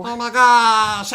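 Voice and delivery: loud, high, slow startled cry; surprised exclamation. Real, not acted. Game master startled by the tackle, loud surprised cry. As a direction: gasp it out loud in shock.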